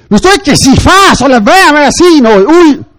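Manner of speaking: loud, high exasperated cry; inner outburst. Retold or imitated. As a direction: burst out in mock rage. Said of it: "Og jeg tænkte". (far tænker højt og vredt)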